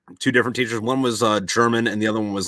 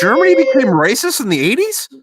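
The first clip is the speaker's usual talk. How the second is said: much higher and louder disbelief; mock incredulous; 14 semitones higher, 7 dB louder than usual. Real, not acted. Retelling his own reaction to the teacher: high, loud disbelief. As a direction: throw it back in shock.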